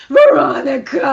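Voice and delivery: loud, hammed-up parting cry; mock cry. Turning back on the way out of theater, mock cry. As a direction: call the name back in mock anguish. Re-enacting her scream while leaving.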